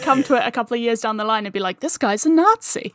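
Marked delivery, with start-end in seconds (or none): 1.8-2.9 a sudden, high, hammed-up gasp of discovery; mock shocked. Imagining finding out an actor's past, mock shocked. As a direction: voice jumps much higher in pretend shock.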